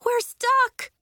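high, loud panic; panicked high-pitched outburst; horrified. A narrator's reading. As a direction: cry out in alarm. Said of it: "Nori was horrified".

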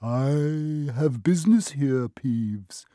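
slow, drawn-out ghostly croak. A narrator's reading. Harry posing as the Baron to Peeves, slow croak. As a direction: croak it slowly like an imperious spectre. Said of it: "croaked Harry".

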